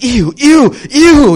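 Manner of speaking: high, loud squealed ew of disgust; mock disgust. Pastor imitating his disgust at another lifestyle, high squealed ew. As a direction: squeal it like something gross touched you.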